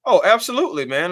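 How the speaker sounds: eager burst of excitement; excited. Real, not acted. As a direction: leap up with excitement. Asked if excited about Hawks.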